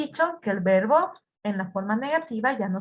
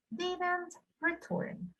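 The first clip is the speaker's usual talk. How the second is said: slow model answer; 7 semitones higher, 10 dB quieter, much slower than usual. Shown as a demonstration. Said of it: "model answer".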